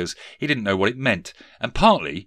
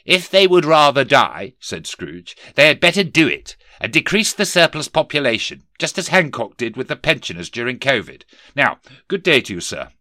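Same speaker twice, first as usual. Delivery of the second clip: higher, louder boss voice of a sneering Scrooge; stern miser boss; 5 semitones higher, 7 dB louder than usual. Acted. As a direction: bark it down at the clerk. Scrooge dismisses collector.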